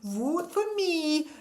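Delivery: slow put-on mealy-mouthed voice; mocking Bridget voice. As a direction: drawl it like a smug politician.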